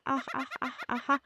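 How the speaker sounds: high-pitched imitation bird cackle; kookaburra laugh. Shown as a demonstration. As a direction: demonstrate a kookaburra's laugh for children. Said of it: "Can you laugh like a kookaburra?".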